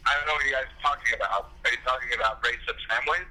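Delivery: caller heard through a phone; phone speaker. Real, not acted. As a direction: voice on a phone line. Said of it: "You're on speaker".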